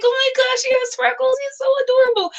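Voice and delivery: very high-pitched, squealed gushing; retold fangirl squeal. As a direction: squeal it like you're swooning over cuteness. Gushing own reaction, retold.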